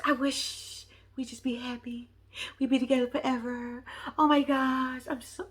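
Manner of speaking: cooing, starry-eyed mimicry; mock dreamy wishing. Mimics her making a wish.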